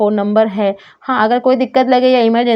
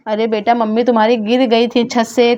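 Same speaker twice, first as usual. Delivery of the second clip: ordinary talk, nothing marked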